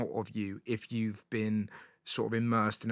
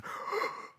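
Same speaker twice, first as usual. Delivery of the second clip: shocked gasp. Retold or imitated. Billy Connolly bit retold.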